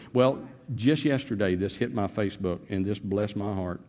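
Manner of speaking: ordinary delivery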